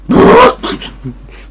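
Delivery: very high, loud gagging sound; retching gag noise. Retold or imitated. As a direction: imitate throwing up. Vomiting gag.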